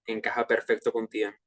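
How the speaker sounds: ordinary talk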